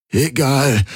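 high, strained croak. A narrator's reading. Garland answering Hawks on where to go, croaking. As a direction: croak it out with effort. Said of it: "krächzte Garland".

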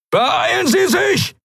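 very high, drawn-out shout; frantic yell into the intercom; screamed order. A narrator's reading. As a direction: scream at them to hurry. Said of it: "schrie Thelios in den Kubus".

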